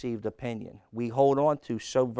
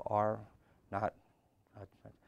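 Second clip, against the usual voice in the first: preacher demonstrates the calm reading Naaman did not use; not screaming; 3 semitones lower, 6 dB quieter than usual. Shown as a demonstration. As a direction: say "Are not, not" mildly to show it wasn't like this. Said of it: "He's not saying it like this".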